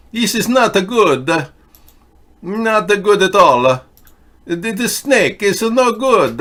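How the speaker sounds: an excitable Italian pleading about the Vatican in a put-on accent; Italian accent, loud and emphatic. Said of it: "My accent is Italiano".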